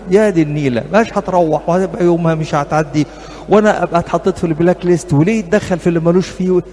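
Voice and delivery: ordinary delivery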